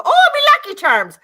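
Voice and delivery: high, loud mock Irish; Irish accent. Showing how she gets into Irish, loud mock Irish accent. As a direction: belt out a hammed-up Irish accent. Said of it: "like, an Irish accent".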